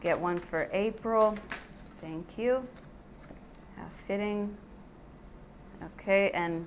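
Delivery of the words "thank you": ordinary talk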